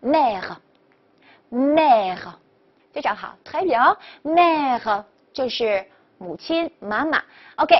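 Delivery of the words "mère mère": "mère" drawn out slowly, uvular r at the end; blended model pronunciation. Shown as a demonstration. Teacher models the word for mother, slow blended pronunciation. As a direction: say "mère" as one slow, joined-up word, pausing between. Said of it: "连起来".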